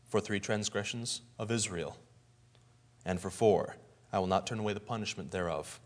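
low, soft, ominous; grave pronouncement. Retold or imitated. Amos turning judgment on Israel, low and grave.

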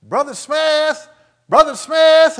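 high, loud shout across the street; a distant man calling out, high and loud. Retold or imitated. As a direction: call his name from far down the road. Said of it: "I started hearing somebody shout".

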